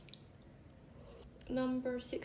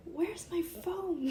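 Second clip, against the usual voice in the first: high, drawn-out retold question; slow, high mock-panicked question; mock distress; 7 semitones higher, slower than usual. Asking a friend for the lost phone, mock distress.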